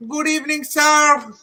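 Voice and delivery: high-pitched mock Habib accent. Gamer greeting the chat as a running gag, Habib accent. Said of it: "with the Habib accent".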